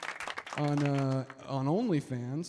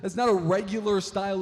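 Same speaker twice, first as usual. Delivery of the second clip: ordinary delivery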